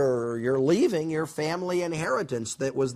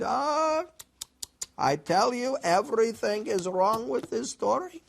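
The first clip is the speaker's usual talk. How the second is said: put-on Yiddish accent; 8 semitones higher than usual.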